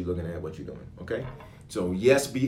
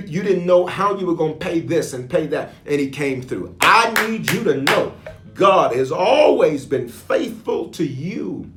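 loud, raised, emphatic sermon delivery; impassioned preaching; 5 semitones higher, 7 dB louder than usual. Real, not acted. Preacher reminds listeners God never let them down, impassioned preaching. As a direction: preach it with rising force.

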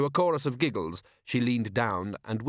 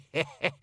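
put-on old woman's cackle; 5 semitones higher than usual. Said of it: "cracked a toothless grin".

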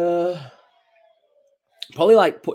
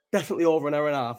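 ordinary talk, nothing marked

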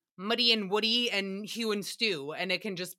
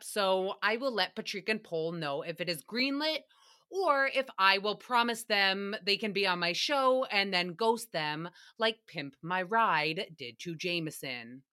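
normal talking voice

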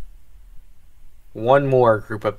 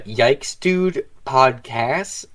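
slow, drawn-out show name; announcer voice; much slower than usual. Acted. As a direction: spell out the title slowly.

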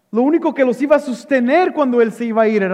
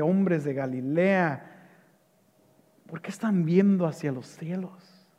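ordinary talk

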